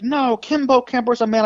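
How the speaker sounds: high-pitched, imitated interviewer protest; exasperated correction.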